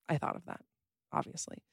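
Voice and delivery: breathy whispered aside. Real, not acted. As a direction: whisper it to yourself. Whispered aside about own code.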